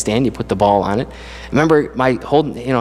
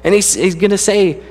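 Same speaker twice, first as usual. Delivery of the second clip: preaching with mounting fervour, pitched higher; rising preacher voice; 5 semitones higher than usual. Acted. Preacher telling his congregation what God gives them, fervent and building. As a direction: lift the congregation with each line, building.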